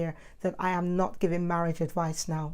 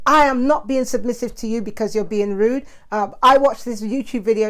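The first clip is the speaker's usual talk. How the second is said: louder, indignant mock stand-off; louder hammed-up indignant employee to boss; mock defiance; 3 semitones higher, 8 dB louder than usual. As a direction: play the employee telling the boss off. Imagined telling off the boss.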